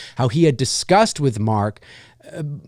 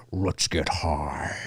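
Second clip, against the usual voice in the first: very low, slow, soft demon voice; pitched-down Freddy; 12 semitones lower, 10 dB quieter, much slower than usual. Retold or imitated. Imitating unprocessed Freddy lines, slow deep rumble. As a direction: drag it down like a slowed demon. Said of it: "I don't like to hear".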